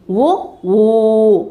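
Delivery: brief ఉ, then ఊ held long and level; teacher sounding out ఉ short and ఊ long. Shown as a demonstration. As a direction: contrast a quick ఉ with a drawn-out ఊ. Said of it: "పలకబడేటువంటి అక్షరాలు ఏమిటి?".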